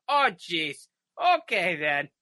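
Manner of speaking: loud, high Fargo-style "Oh, jeez. Okay, then."; hammed-up Fargo drawl; Fargo Minnesota accent. Comic quoting Fargo in a broad Minnesota accent. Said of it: "I've been watching Fargo".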